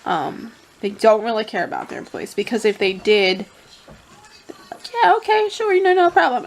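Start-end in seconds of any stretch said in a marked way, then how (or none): 5.0-6.5 high, sing-song fake cheer; mock the bosses with sugary sarcasm; sarcastic mock agreement. Mocking the company's fake agreement.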